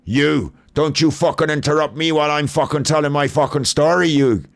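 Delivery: mock furious rant in a put-on accent; angry Jim impression. Acting out Jim furious at Luke for interrupting, hammed-up angry rant. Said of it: "if I'm doing his accent".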